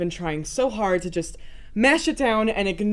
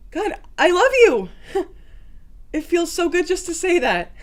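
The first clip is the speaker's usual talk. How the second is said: elated outburst; 6 semitones higher than usual. Acted. Finally telling him she loves him, elated and relieved. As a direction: let the love burst out in relief. Joyful release in love confession.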